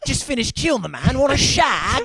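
loud, high mock British boast; brash put-on British accent, building in force; James Bond. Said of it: "That's classic Bond".

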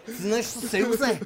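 high, slow, laughing Scouse delivery; Liverpool accent. Shown as a demonstration. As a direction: say the name in a thick Scouse accent. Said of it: "his name said in a Liverpool accent".

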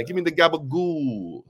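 hammed-up Italian-American food order; mock Italian-American. Co-host riffing on the guest's Italian, mock Italian-American. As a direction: play up a joke Italian-American accent. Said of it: "Where's your Italian at?".

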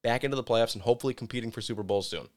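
ordinary talk, nothing marked

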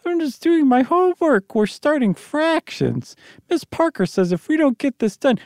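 high, whiny schoolboy voice; a kid protesting about homework; young boy voice. Acted. Tommy's voice in reading.

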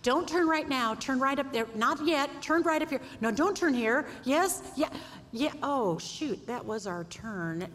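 frantic navigator. Retold or imitated. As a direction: panic over the turn, then deflate.

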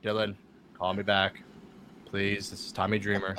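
every word on the same low note; Tommy Dreamer monotone. Retold or imitated. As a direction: imitate a droning, lifeless voicemail. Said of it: "No one talks in".

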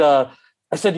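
usual speaking voice

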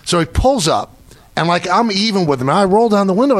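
ordinary talk

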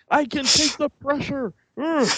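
very high, strained little voice; squashed little guppy. Acted. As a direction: squeal it out like a straining tiny fish. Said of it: "like a little guppy up against a little screen".

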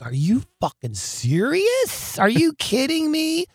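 drawn-out, rising disbelief; mock-astonished buddy, pitch climbing high; incredulous. Friends react to his wild stories at dinner, incredulous.

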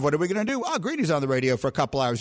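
usual speaking voice

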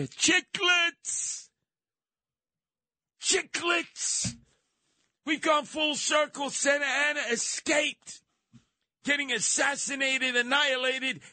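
high, exclamatory yelling; excited shout. Acted. Punchline of the Chiclets bit.

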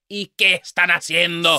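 loud, high Spanish scolding; mock scolding mom. Host imitating the mom scolding her kids, loud and high. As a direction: bark it like an annoyed mother. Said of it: "she's like".

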